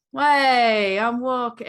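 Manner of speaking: loud mock New York holler; jokey, exaggerated New York accent. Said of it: "just doing a New York accent".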